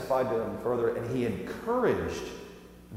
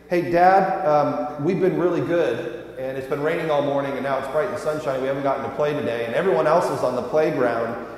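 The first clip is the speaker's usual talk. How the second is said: rapid run-on litany, louder at the edges; imitated pleading kids; 3 semitones higher, 5 dB louder than usual. Dad imitating his kids begging to play, a rushed run-on plea. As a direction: rattle off reasons without stopping, like a child. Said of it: "They keep going. They say".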